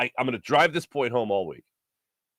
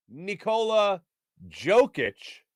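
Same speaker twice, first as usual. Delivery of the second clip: said slowly; 4 semitones higher, much slower than usual. Shown as a demonstration. Host telling two similar names apart, saying it slowly. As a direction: spell the name out by ear, word by word. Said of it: "just to say it slowly".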